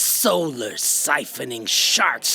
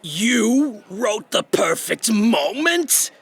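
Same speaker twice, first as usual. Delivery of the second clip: amazed; 6 semitones higher, slower than usual. Acted. Amazed discovery.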